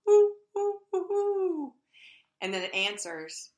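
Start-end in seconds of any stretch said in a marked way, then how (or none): 0.1-1.7 high, loud owl hooting; owl call. Retold or imitated. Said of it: "I stand on the porch and I go".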